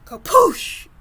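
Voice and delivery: loud, high shouted kapoosh; crash sound effect. Acted. Storyteller voicing the bed collapsing, loud high crash yell. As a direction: blast out the crash noise. Bed breaks under giant.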